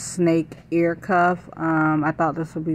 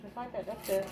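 gentle coaxing; 7 semitones higher, 13 dB quieter than usual. Real, not acted. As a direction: coax a little one softly. Coaxing her child.

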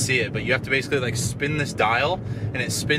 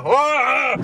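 frustrated yell; 11 semitones higher, 3 dB louder than usual. Real, not acted. Frustrated over the burger.

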